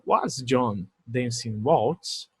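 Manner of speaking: very slow question, rising; slow model question. Shown as a demonstration. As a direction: stretch the question for students. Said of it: "Aqui é uma interrogativa". (pergunta lenta e pausada)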